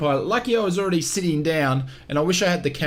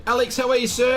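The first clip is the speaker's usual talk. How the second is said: normal talking voice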